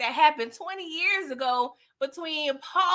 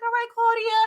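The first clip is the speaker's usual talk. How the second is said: high, approving call-out; a high, approving shout to Claudia on screen; high cheer; 11 semitones higher than usual. Real, not acted. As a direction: cheer her on, pitched up. Host cheers character.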